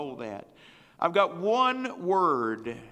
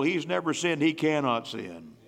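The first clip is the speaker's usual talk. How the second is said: ordinary talk, nothing marked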